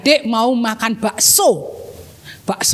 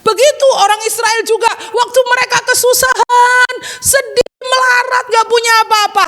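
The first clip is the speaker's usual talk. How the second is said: high, raised storytelling voice; impassioned preaching; 9 semitones higher than usual. A narrator's reading. Teacher tells children of Israel's suffering, impassioned. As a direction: preach their misery with rising urgency. Dramatic telling of Israel's distress.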